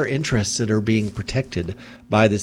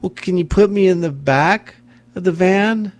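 higher, louder imitation of a polite, desperate woman; polite plea; 8 semitones higher, 6 dB louder than usual. Host voicing the dying woman's polite request, higher and pleading. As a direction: voice her politely asking for help. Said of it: "She's still trying to be polite".

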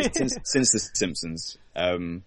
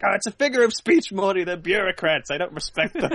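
much higher, louder offhand mock Rick; Rick impression; 11 semitones higher, 4 dB louder than usual. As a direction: shrug it off, hammed-up. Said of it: "He's like".

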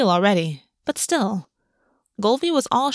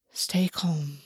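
hushed whisper to himself; 4 semitones lower, 8 dB quieter than usual. A narrator's reading. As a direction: breathe it out, barely voiced. August steadies himself silently.

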